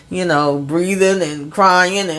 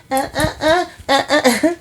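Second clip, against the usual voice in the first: high excited panting laugh; 9 semitones higher than usual. Real, not acted. Overjoyed reaction after news.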